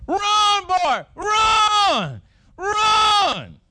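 high, loud repeated yell; shouting run. Retold or imitated. Third base coach yelling at big runner, loud shout. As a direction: urge him on at the top of the voice. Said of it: "everybody was telling me to say".

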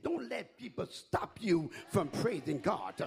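ordinary delivery

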